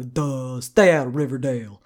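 lightly voiced dim jock, "Duh" low; dopey Moose. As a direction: play a dumb bruiser warning someone off. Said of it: "I think of him sounding like".

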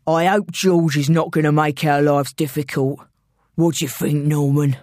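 low London gangster voice; London villain. Acted. Intruder conferring with his accomplice, low villain voice. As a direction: speak low as a London heavy.

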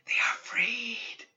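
a slow whisper, as if screamed from far away; a scream heard as only a breath; distant whispered scream. A narrator's reading. Spirit crying out from afar, distant whispered scream. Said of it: "screaming in the darkness".

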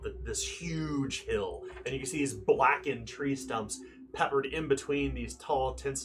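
ominous storyteller description; dramatic scene setting. Acted. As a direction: set the scene with weight, lingering on the windmill.

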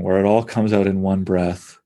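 usual speaking voice

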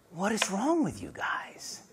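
soft, half-whispered exasperation; hushed rebuke. Acted. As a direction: chide the frightened disciples under your breath. Jesus waking, chiding frightened disciples.

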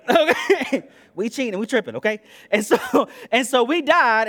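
loud, laughing through the words; laughing preacher. Real, not acted. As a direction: chuckle as you push on.

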